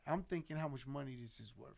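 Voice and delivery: ordinary talk, nothing marked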